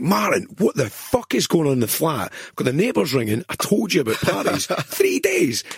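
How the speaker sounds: high, loud mock telling-off; mock furious call. Ringing Martin over the three-day party, mock furious and loud. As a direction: tear a strip off a flatmate, half laughing.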